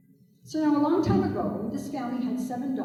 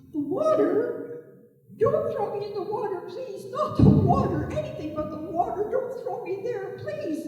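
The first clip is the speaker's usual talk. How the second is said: much higher, fake-frightened begging; pleading turtle; 9 semitones higher, 5 dB louder than usual. A narrator's reading. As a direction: beg with mock terror. Turtle pleading in mock fear.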